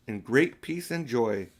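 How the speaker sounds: ordinary delivery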